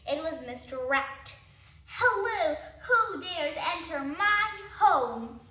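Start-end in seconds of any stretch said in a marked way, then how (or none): 1.9-5.4 loud, higher rat voice challenging visitors; gruff rat. Acted. Rat challenging intruders at his home, loud and high. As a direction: boom it out as the guarding rat.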